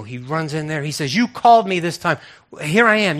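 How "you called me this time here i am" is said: raised, louder insistence; boy pressing his point; insistent Samuel. Acted. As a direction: insist with voice pitched up. Samuel's third run to Eli, acted.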